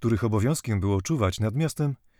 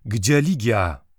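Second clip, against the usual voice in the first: slow, louder, dread-filled demand; terrible changed voice; 5 dB louder, much slower than usual. A narrator's reading. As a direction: drag the question out with menace. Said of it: "zawołał strasznym, zmienionym głosem".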